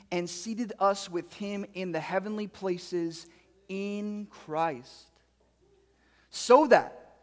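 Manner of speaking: measured, slow reading of a Bible passage; scripture reading. Acted. Pastor reading Ephesians to the congregation, measured and slow. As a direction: read the verses out as solemn text.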